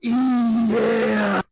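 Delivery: loud hype yell into the mic; shouted yeah. Retold or imitated. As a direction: belt it like a wrestler. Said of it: "And then I say, like".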